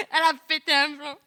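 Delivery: high, loud imitation through laughter; laughing mock verdict. Boys joking about the girl eating heartily, laughing verdict. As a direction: laugh as you declare she's lost it.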